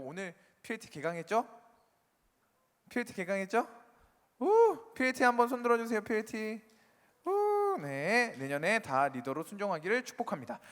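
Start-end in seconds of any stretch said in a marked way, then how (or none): 4.4-4.9 high, loud "woo" cheer; short excited whoop. Real, not acted. Cheering the congregation on. 7.3-7.8 high, loud "woo" cheer; short excited whoop. Real, not acted. Cheering the congregation on.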